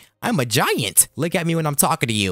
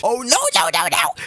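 high-pitched frantic cry; hammed-up yelps of alarm; mock panic; 10 semitones higher, 3 dB louder than usual. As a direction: cry out as danger closes in. Cats come after the player.

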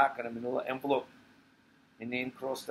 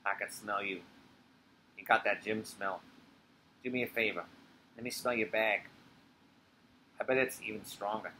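low man's voice; 4 semitones lower, 6 dB quieter than usual. Acted. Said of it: "she becomes Ray".

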